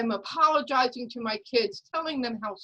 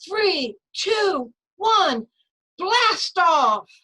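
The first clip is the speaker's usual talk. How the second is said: countdown climbing higher and louder to a big blast off; building excited countdown; 9 semitones higher, 8 dB louder, much slower than usual. Retold or imitated. Mother launching the elevator rocket, building to a loud blast off. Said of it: "and I say".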